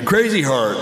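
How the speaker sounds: high, drawn-out laid-back dude impression; impersonated film-star dude; Jeff Bridges. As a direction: relish it like an easygoing dude.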